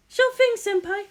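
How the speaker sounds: put-on high, timid young woman; Maya voice. Maya answers her senpai, high timid voice. Assistant voice.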